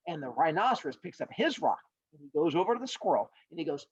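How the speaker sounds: plain reading voice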